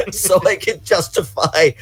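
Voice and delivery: high-pitched, giggly admission; laughing confession. Real, not acted. Hobbyist admitting motive for recruiting friends, laughing. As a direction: laugh through it, pitch rising to the end.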